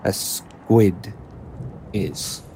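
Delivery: each word said slowly and carefully, as if "squid" were being tasted; savouring the word. A narrator's reading. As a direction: roll "a squid is" round the mouth like a new taste. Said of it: "he phrases the word very carefully, like he's tasting it".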